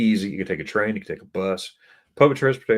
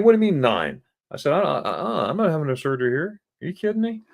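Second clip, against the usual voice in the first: high-pitched, flustered disbelief; retold balking at the doctor, voice climbing; indignant protest; 9 semitones higher than usual.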